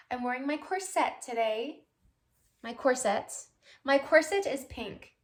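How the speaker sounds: each "corset" said cor-SET; "corset" stressed on SET; stress on second syllable. Shown as a demonstration. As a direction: put the weight on the end of "corset". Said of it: "emphasis or the accent on the second syllable".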